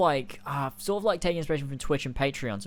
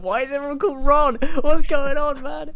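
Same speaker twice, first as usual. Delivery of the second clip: high, loud, fast disbelieving question; incredulous fan; 12 semitones higher, 11 dB louder than usual. Pretend. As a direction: squeal it like a baffled viewer. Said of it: "be like".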